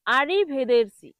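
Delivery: slow আরিভেদেরসি with "der"; careful model আরিভেদেরসি, "e" before rs; correct pronunciation. Shown as a demonstration. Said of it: "এটার সঠিক উচ্চারণ হবে".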